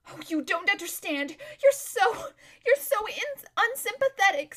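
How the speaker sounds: high, tearful, halting complaint; beginning to cry. A narrator's reading. Lazareline calling her friend unsympathetic, starting to cry. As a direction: stammer it out on the edge of tears. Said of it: "beginning to cry".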